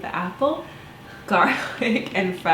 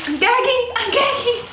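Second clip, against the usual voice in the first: high, loud disgusted squeal; disgusted gagging cry; 4 dB louder than usual. Real, not acted. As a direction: yelp in real disgust at the raw bird. Disgusted by raw turkey.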